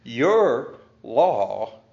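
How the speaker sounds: "Your" drawn out and stressed. Shown as a demonstration. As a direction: lean into "Your". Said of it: "notice that emphasis there on the word".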